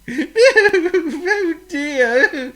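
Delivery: a sorry little bird; tearful little bird sigh. Acted. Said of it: "he sighed".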